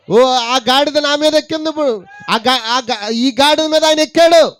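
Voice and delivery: rally the church like a revival shout; preaching at full force. Acted. Preacher rousing worshippers to shout praise, full force.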